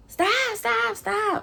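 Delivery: very high, frantic pleading; imitating a man under attack, voice way up; high-pitched mock yelp; acted panicked cry. Attacker cries out as bottles fly.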